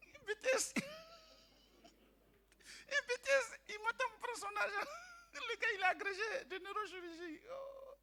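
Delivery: high voice breaking into laughter; giggling, high-pitched retelling; laughing high. Retold or imitated.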